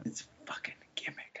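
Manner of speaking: whispered under the breath; very quiet, voiceless grumble; muttered aside. Real, not acted. Repeats complaint under breath.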